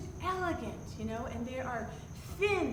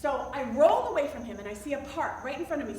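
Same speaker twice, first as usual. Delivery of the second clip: usual speaking voice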